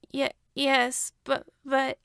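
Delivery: put-on high, halting child's voice; stammering boy. A narrator's reading. Hitoshi stammers to Missus Midoriya, unsure. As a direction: stumble over the words like a startled kid.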